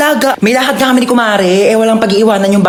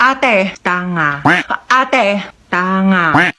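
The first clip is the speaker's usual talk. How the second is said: very slow child mimicry; slow toddler talk; 3 semitones lower, much slower than usual. Toddler slowly repeating the bad word to the sister, slow child voice. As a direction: drag the words like a toddler learning.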